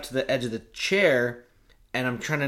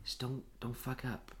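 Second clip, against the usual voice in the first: much softer, confiding plea; hushed warning; 12 dB quieter than usual. Retold or imitated. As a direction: lean in and warn him quietly. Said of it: "I'm like".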